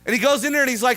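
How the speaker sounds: ordinary delivery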